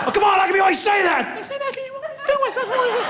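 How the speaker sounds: very high mock shouting; wife's argument voice. Wife argues with husband in a high squeaky mock shout. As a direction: squeal back in a fake quarrel. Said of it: "screaming matches".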